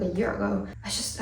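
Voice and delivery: ordinary talk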